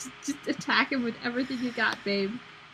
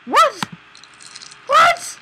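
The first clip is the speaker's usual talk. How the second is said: high startled shout of what; shocked; 10 dB louder than usual. Real, not acted. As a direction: jolt up, loud and disbelieving. Shocked by gross game scene.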